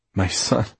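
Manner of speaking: scoffing chuckle before speaking; amused disbelief. Acted. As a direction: laugh off the suggestion. Laughs at the idea.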